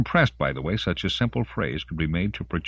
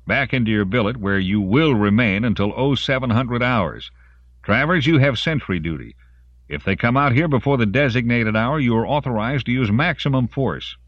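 loud, raised military command; issuing orders; 6 semitones higher, 4 dB louder than usual. A narrator's reading. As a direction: sergeant laying down orders, higher pitched. Sergeant giving orders to his men.

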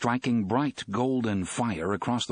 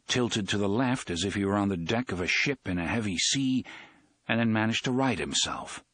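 usual speaking voice

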